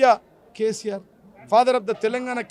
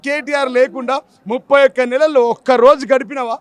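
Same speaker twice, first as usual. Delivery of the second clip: usual speaking voice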